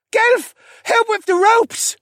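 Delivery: slow, very high, loud call; yell. Acted. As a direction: yell for help across the deck. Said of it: "Rylan yelled".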